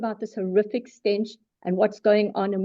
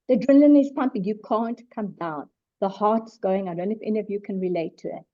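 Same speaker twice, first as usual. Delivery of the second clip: normal talking voice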